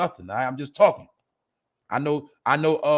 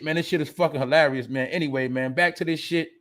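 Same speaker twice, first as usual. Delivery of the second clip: usual speaking voice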